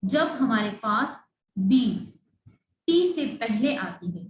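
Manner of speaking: ordinary delivery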